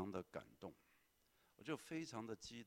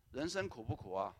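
ordinary talk, nothing marked

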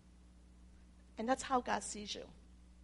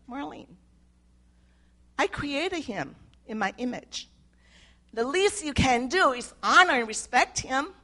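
God's voice, firm and louder by the end; a gentle rebuke that grows into a firm command; the Lord speaking; 5 semitones higher, 8 dB louder than usual. Retold or imitated. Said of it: "He said".

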